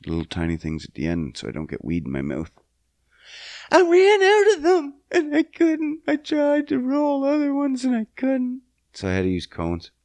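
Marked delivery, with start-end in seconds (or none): 3.7-8.6 mock crying. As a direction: whine it like a hammed-up sob. Mock crying over trivial trouble.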